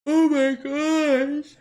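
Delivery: slow, loud yawning words; real yawn. Real, not acted. Vlogger to chat, yawning mid-sentence loudly. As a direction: let the yawn stretch the words out. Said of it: "that was so rude".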